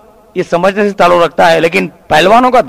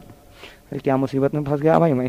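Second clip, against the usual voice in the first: low, very soft mutter to himself; worried aside; 4 semitones lower, 9 dB quieter than usual. Acted. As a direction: moan privately about being stuck. Trapped comedian grumbles to himself. (दबी आवाज़ में खुद से)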